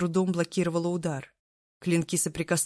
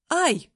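high sudden ouch; sharp yelp of pain; 11 semitones higher, 6 dB louder than usual. Acted. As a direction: yelp as the stick slaps your leg. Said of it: "воскликнула я".

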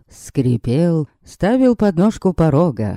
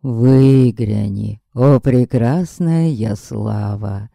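slow, drawn-out lovesick declaiming; vampire serenade; 3 semitones lower, slower than usual. Acted. Vampire serenading Yaslava under her window, slow and pompous. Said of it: "Ишь, надрывается!".

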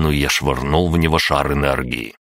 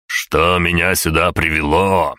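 plain reading voice

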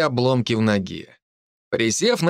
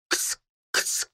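voiceless, hushed kitty-call; whispered call; 7 dB quieter than usual. A narrator's reading. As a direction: whisper the call under your breath.

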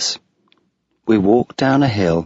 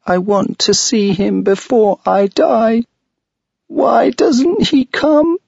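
put-on a child's voice, much higher, louder and slow, in tears; crying little girl; 4 dB louder than usual. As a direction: wail it out like a desperate little girl. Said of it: "she began to cry".

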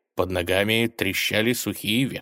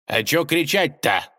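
grumbled retort, pitched up; grumbling; 7 semitones higher, 4 dB louder than usual. A narrator's reading. Unafraid tsar answering the robber's shout, grumbling. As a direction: grumble back, unimpressed. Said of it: "проворчал государь".

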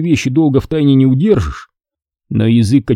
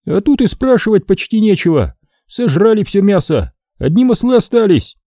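raised, grumbling soldier voice; lightly voiced greedy soldier, a bit put out; a high, whiny voice complaining about scraps; Kachan's voice; 9 semitones higher than usual. A narrator's reading. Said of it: "Качан complains, voice much higher".

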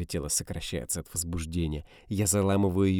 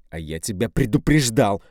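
louder snapped retort; angry shout; 3 semitones higher, 9 dB louder than usual. A narrator's reading. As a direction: bark it at her. Man answering her screams.

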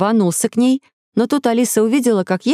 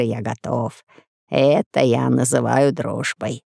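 merchant voice; 6 semitones lower than usual. A narrator's reading. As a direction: speak as a deep-voiced trader.

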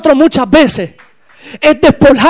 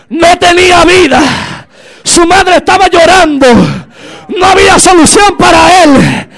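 strained, shouted pulpit storytelling; impassioned preacher building to the miracle; full-force preaching; 4 semitones higher, 4 dB louder than usual. Real, not acted. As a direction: cry out each phrase to the congregation.

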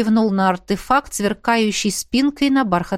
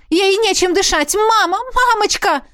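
паника; 11 semitones higher, 3 dB louder than usual. Acted. Дочь в панике.

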